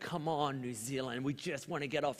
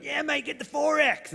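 rowdy Aussie blokes; 12 semitones higher, 6 dB louder than usual. Retold or imitated. Aussie dudes at Sorrento station.

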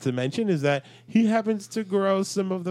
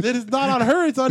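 indignant; 10 semitones higher, 7 dB louder than usual. Real, not acted. Arguing the point.

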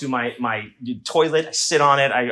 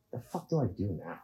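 low, soft muttered despair; 4 semitones lower, 13 dB quieter, faster than usual. Retold or imitated. Comedian sitting alone in the dark after the embarrassment, soft low mutter.

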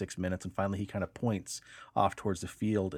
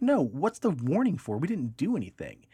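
indignant; 7 semitones higher, 3 dB louder than usual. Retold or imitated. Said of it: "she's like".

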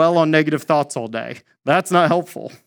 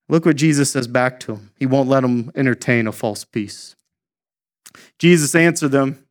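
ordinary talk

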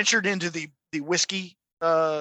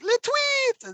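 squeaky-high, louder bird chirp; cartoon bird; 5 dB louder than usual. Bird on a branch as the skunk passes, high loud chirp. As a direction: chirp it out high before keeling over. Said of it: "and the bird's like".

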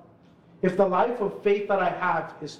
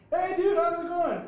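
cheery greeting; 12 semitones higher than usual. Retold or imitated. Panhandler friend greets him.

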